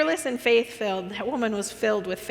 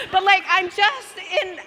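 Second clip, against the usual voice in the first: ordinary delivery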